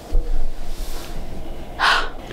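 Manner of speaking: long breathy swooning sigh; dreamy sigh. Real, not acted. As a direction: sigh like you're melting. Dreaming of fictional dad.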